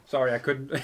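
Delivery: ordinary talk, nothing marked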